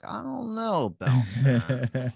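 slow, drawn-out doubtful musing; mock-thoughtful, stretched-out doubt; skeptical pondering. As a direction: ponder it like a chin-stroking skeptic. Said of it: "stroking your chin".

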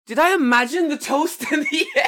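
high, loud question breaking into laughter; laughing disbelief. Retold or imitated. Seeing the bill without toast, laughing in disbelief.

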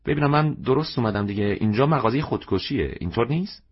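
put-on high, anxious young woman's voice; lightly voiced flustered young woman. A narrator's reading. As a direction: ask nervously, unsure you came to the right place. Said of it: "زن جوان آشفته".

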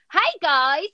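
ordinary talk, nothing marked

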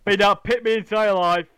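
put-on loud, broad Yorkshire accent laid on thick; thick northern accent. Mocking himself for putting on a northern accent with strangers, broad Yorkshire accent. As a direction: ham up a broad northern accent about working down the pit. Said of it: "it just comes on thick and fast".

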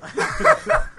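high-pitched burst of laughter; laughing hard, then fading out. Real, not acted. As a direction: crack up at the punchline. Laughs after joke.

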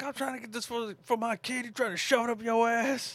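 mock high-pitched voice. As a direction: squeak it out high. Mock Sinbad voice.

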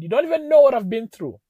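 loud, high imitated outburst; mock tearful cry of protest; bursting into tears. Wife protesting to angry husband, retold tearful outburst. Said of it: "burst into tears and said".

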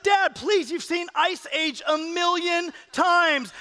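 high, pleading mock complaint; exasperated kids. Kids to dad crying at Ice Age, exasperated. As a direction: drag out the end in high-pitched exasperation.

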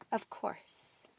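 hushed, voiceless hissy snigger; reply in a sly snake's whisper. A narrator's reading. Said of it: "The snake sniggered".

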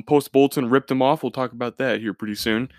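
normal talking voice

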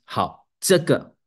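normal talking voice

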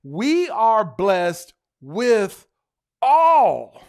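very slow, then "all" shouted high; drawn-out line ending in a loud, high "all"; stress on ALL. Shown as a demonstration. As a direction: build slowly to a loud "all". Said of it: "So the emphasis is".

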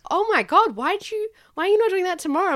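high, astonished questioning; mock amazement, pitched high; imitated amazed classmates. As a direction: act out friends who can't believe it. Acting out classmates' amazed reaction.

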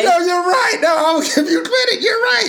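high, loud laughing shout of surrender; laughing concession. Real, not acted. A man laughing as he concedes the point to Kim. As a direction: crack up while giving her credit.

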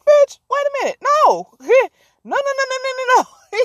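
high outraged no, no, no; outraged disbelief. Real, not acted. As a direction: shut it down in mock horror. Speaker reacts in outrage.

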